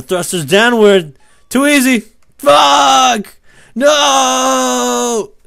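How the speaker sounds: rising from talk to high screamed dismay; loud, escalating gamer meltdown; building to screaming. Real, not acted. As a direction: start instructing, then climb into a long scream. Triumph turns to failure in game.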